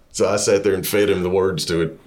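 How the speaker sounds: ordinary talk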